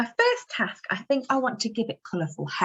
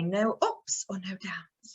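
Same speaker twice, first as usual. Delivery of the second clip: whisper; 7 dB quieter, slower than usual. Acted. Teacher whispering a question.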